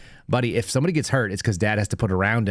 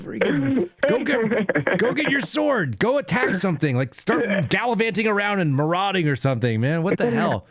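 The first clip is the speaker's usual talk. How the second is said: high-pitched, laughing, mock-exasperated rant; cracking up while pleading with a crazy kid; 9 semitones higher, slower than usual. Dad retelling how he pleaded with his gum-obsessed son, high and laughing.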